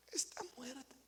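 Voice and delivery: wife mock-grieving her blender; mock-sad whisper. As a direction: play it as a tragic loss. Wife sadly reports.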